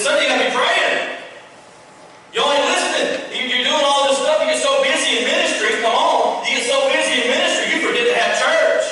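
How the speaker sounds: high and loud, driving the point home; rising preacher's call, high and loud; preaching at full force. Acted. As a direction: preach it hard to the congregation. Preaching climax.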